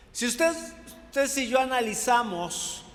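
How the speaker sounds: usual speaking voice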